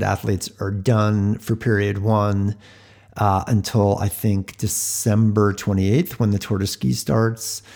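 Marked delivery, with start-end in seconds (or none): none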